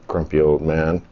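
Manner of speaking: low, soft mutter; self-mocking grumble. Real, not acted. Man calling himself names while typing, grumbling low.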